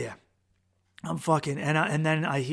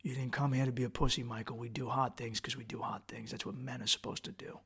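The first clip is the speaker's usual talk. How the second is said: muttered low self-command, much softer; tough inner pep talk; 4 semitones lower, 13 dB quieter than usual. Retold or imitated. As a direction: grit it under your breath to yourself. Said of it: "I say".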